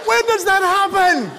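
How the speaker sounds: high, slow disbelief; baffled, high, slow; incredulous. Real, not acted. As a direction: ask it in comic disbelief. Incredulous comic question.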